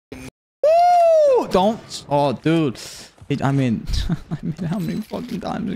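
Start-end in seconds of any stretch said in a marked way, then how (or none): 0.1-1.5 high piercing wrestler woo; loud woo. Real, not acted. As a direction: let out a huge celebratory woo. Woo shout.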